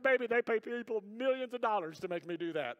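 exaggerated self-defence to a wife; mock-indignant husband. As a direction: plead your case with hammed-up indignation. Husband's comic defence, acted.

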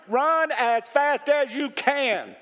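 high, drawn-out, forceful warning; emphatic warning. Real, not acted. Preacher warning the congregation off faith healers, emphatic warning.

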